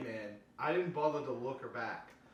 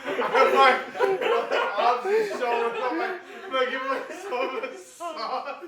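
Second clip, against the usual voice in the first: high, laughing through the words; 13 semitones higher, 13 dB louder, slower than usual. Real, not acted. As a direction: crack up while talking. Cracking up mid-scene.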